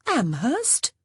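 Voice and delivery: mild exclamation of disbelief; crying out. A narrator's reading. Honor reacting to her sister's chosen suitor, crying out in disbelief. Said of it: "Honor cried".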